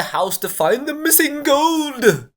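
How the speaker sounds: high, bad Irish leprechaun accent. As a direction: put on a clumsy leprechaun accent. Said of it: "I was trying to do, like, a leprechaun accent".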